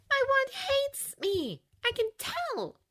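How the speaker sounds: slow, high and upset; a hurt girl insisting; deeply upset. A narrator's reading. Girl showing Harry her old wand, slow and upset. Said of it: "sounding deeply upset".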